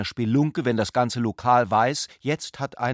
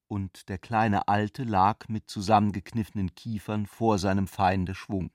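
ordinary delivery

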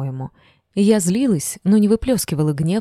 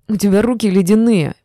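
higher, louder squealed protest; 4 semitones higher, 6 dB louder than usual. A narrator's reading. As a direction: squeal it loudly. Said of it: "Писк Фина". (громко, с писком)

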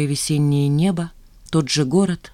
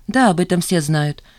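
ordinary delivery

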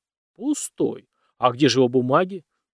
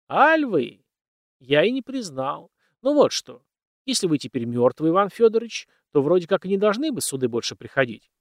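plain reading voice

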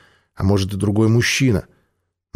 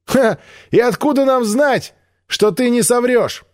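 high mocking call; scornful shout; 13 semitones higher than usual. Мира насмешливо кричит Альпу, не веря ему. Mira mocks distrustfully.

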